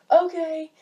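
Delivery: high, loud acted reply; put-on acknowledgement. You to relatives barging into your room, put-on acknowledgement.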